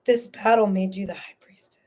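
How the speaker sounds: reverent whisper of the card's meaning. Real, not acted. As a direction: hush it like a revelation. Repeats card meaning hushed.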